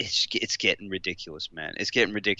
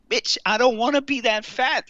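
high, indignant retort; mock outrage; 12 semitones higher than usual. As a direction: fire back in a high indignant voice. Retold refusal at McDonald's.

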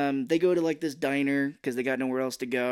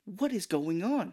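high, slow, drawn-out question; hammed-up confusion, pitched up; mock bewilderment; 6 semitones higher, slower than usual. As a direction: imitate a whiny, baffled demand. Mark confronting the conductors.

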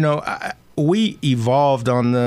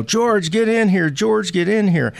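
mom yelling; 7 semitones higher than usual. Retold or imitated. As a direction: yell it like a mom at the door.

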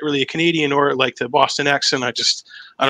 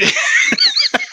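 ordinary delivery

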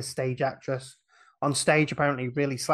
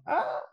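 mock scream. Recapping the audience's reaction, a high mock scream. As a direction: squeal a quick pretend scream. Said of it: "And we scream".

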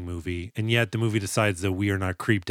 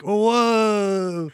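high, drawn-out "whoa"; mock impressed crowd; 9 semitones higher, 3 dB louder than usual. Classmates reacting to campaign promises, mock-awed high whoa. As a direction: play an awed crowd of schoolkids.

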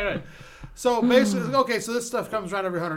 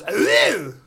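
put-on loud honking "ugh" like an old horn; old car horn; 8 dB louder than usual. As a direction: blare it out like a vintage car horn. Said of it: "the horn that goes".

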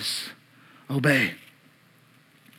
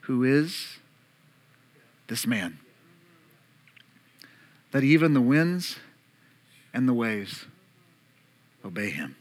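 much softer, fading awestruck question; awed hush; 8 semitones lower, 7 dB quieter than usual. Retold or imitated. Disciples wondering about Jesus, hushed awe. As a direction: ask it in quiet wonder. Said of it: "here's the question".

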